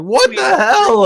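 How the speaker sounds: loud, high, drawn-out disbelief; exasperated guard. Acted. Guard confronting the collapsed satyr, acted exasperated shout. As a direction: shout it slowly, as a baffled guard. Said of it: "he's like".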